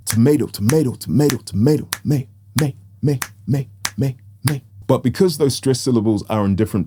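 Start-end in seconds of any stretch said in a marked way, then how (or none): 0.0-4.6 even chant of "Tomato", punched on MA; rhythmic repetition. Shown as a demonstration. Teacher isolating the stressed syllable, rhythmic chant. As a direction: loop "Tomato" evenly, then only the stressed "may". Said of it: "repeat each of these words".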